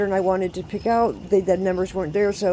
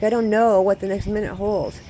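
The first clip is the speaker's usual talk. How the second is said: normal talking voice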